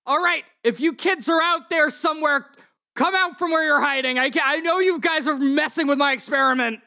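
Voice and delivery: high-pitched villain calling out; angry doctor. Acted. Game master as angry doctor calling out hidden kids, high voice. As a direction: call out high to kids in hiding. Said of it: "and he's like".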